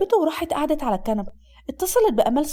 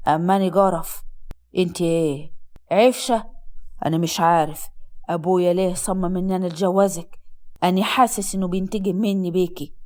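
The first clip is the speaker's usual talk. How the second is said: low-pitched man grumbling bitterly; drunk, bitter husband; 6 semitones lower than usual. Acted. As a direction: complain in a deep, disgusted drunken voice.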